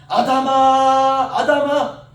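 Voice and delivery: long, drawn-out loud call. Acted. God calling out to Adam in the garden, drawn-out loud call. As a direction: call out the name slowly across a garden.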